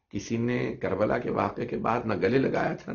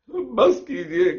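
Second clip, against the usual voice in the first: slow, louder, much higher cry of बस कीजिए; wailing plea; 10 semitones higher, 7 dB louder, much slower than usual. Acted. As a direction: drag बस कीजिए out as a loud sobbing wail. Nephew begs aunt to stop. (बस कीजिए को धीमे, ऊँचे, रोते हुए पुकारना)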